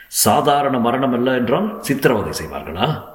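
defiant question, pitch lifted; challenging retort. Acted. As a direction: throw it back as a challenge. Hero challenges her trembling warning.

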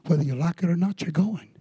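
normal talking voice

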